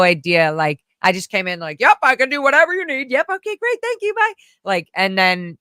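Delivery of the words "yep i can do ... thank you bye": much higher, fast and chirpy yes-yes; eager phone voice. Retold or imitated. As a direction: gush to the caller, eager to please. Eager job-seeker on phone.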